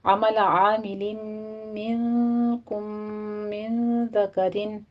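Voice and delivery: very slow recitation of عمل عامل, منکم, من ذکرن with held vowels; tajweed demo. Shown as a demonstration. Teacher demonstrating word by word, very slow and drawn out. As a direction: drag each word out to show the counts. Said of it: "کرنا ہے".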